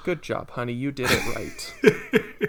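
drawn-out praise like a proud mother; mock mom cheering her son on; imitating his mom. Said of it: "my mom is just saying".

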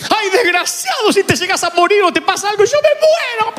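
mother to her lost son, mock frantic wail. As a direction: overdo the panicked mum who can't lose him. Mother scolding the lost child.

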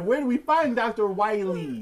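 crisp careful diction on every word; extra clear. Retold or imitated. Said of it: "the girl said it extra clear".

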